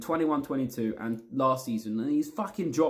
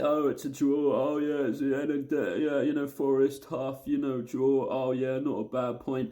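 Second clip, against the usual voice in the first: lightly mocking take on an over-positive fan. Fan imitating over-positive supporters who accept a draw, lightly mocking. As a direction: shrug off the draw, a mild mock voice. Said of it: "the over-positive ones that say".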